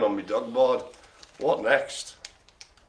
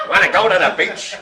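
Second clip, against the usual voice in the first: louder mock Aussie, laughing through it; mock Australian accent; 4 semitones higher, 6 dB louder than usual. Host mocking the Australian impression, laughing Australian accent. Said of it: "can't do an Australian accent".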